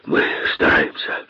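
low, breath-heavy reply; straining guards. A narrator's reading. Guards answer the python while dragging, straining. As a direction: answer under effort, voice dropped.

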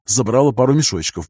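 plain reading voice

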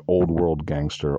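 ordinary talk, nothing marked

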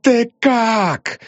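very slow, high outburst; outraged shout. A narrator's reading. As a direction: explode in outrage. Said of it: "вскричал полковник".

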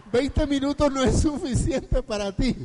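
loud, slow question through laughter; amused disbelief. Acted. Therapist answers patient's request, amused disbelief.

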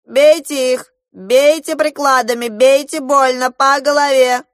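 loud, high string of orders; frenzied military yelling; enraged general. A narrator's reading. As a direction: shout each command relentlessly.

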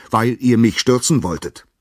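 angry god calling down from the sky. A narrator's reading. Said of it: "rief er ihnen von oben zu".

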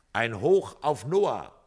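slow, loud toast; calling out a toast. A narrator's reading. Casey toasting Noah aloud to the group. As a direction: proclaim the toast slowly. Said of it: "rief er".

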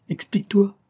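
sharp, high retort; a vexed boy's high demand. Acted. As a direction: snap back, piqued. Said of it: "vexé à son tour".

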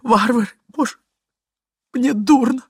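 высокий страдальческий голос дамы; причитай высоко, будто дурно; причитание дамы. A narrator's reading. Дама падает в обморок, причитает. (высоким голосом, жалобно)

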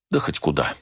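low, casual toss-off; offhand. A narrator's reading. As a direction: throw it away without interest. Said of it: "бросила Рахель".

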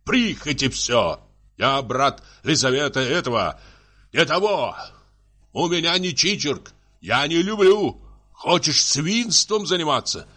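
high, indignant scolding; heated domestic tirade; shouting husband. Acted. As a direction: rant at a wife, pitch pushed up. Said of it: "закричал супруг".

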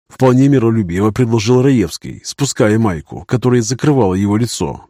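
ordinary delivery, nothing marked

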